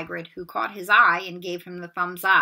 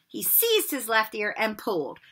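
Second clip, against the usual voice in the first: usual speaking voice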